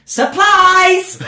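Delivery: high, loud shout; yelled. Acted. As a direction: leap out and yell it. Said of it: "jumped out ... and yelled".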